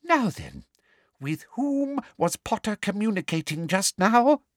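high, sugary witch of a teacher; a prim, high interrogator's voice; prim high schoolmistress grilling a girl; Umbridge voice. A narrator's reading.

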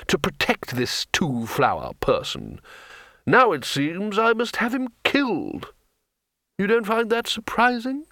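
a touch of a cold ruler; patrician voice. A narrator's reading.

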